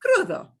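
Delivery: "Crudo" with a light tapped r; teacher model. Shown as a demonstration. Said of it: "Here's a word that means raw".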